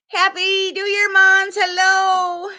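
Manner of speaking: high, sing-song hello to a pet; high sweet pet talk; pet greeting voice. Real, not acted. Greeting her pet by name.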